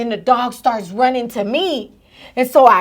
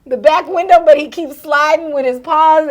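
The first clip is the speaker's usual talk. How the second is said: ordinary talk, nothing marked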